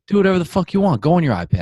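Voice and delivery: fed-up parent snapping, do whatever; exasperated outburst. Retold or imitated. Parent at wit's end snapping at the kid, exasperated.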